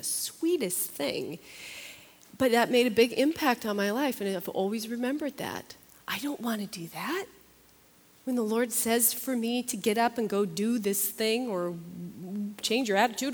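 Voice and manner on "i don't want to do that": whispered confession; admitting reluctance in a whisper. Real, not acted. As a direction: breathe it out as a whisper. Confessing her own reluctance.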